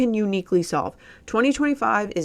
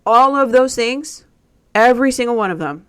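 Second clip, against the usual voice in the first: emphatic loud; 4 semitones higher, 9 dB louder than usual. Real, not acted. Emphatic point to the audience.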